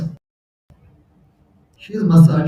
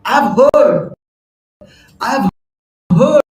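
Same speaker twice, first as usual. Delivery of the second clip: mildly irritated wife; 6 semitones higher, slower than usual. Retold or imitated. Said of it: "concerned and irritated too".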